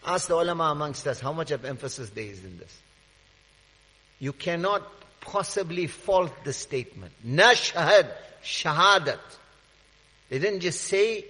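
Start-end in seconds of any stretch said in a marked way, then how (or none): none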